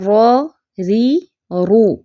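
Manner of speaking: را ری رو each said slowly and clearly; slow Quranic letter drill. Shown as a demonstration. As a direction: model the ر with each vowel. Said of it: "را کی ادائیگی اور تلفظ دیکھتے ہیں".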